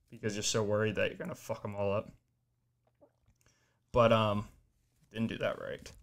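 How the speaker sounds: usual speaking voice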